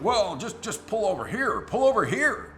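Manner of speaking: loud, high, insistent pleading; urgent mock demand. Passenger demands the driver pull over, loud and rising. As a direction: press harder with each repeat.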